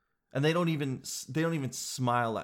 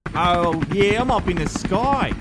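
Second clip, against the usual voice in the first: yelling over helicopter; 4 semitones higher, 6 dB louder than usual. Acted. Sounds like you're in a helicopter.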